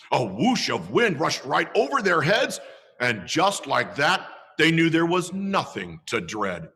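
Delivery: usual speaking voice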